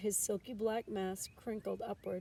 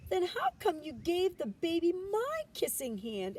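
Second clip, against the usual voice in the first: put-on higher, louder child voice; questioning child; 10 semitones higher, 6 dB louder than usual. A narrator's reading. Chester questions his mother, higher child voice. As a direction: push back like a hurt kid.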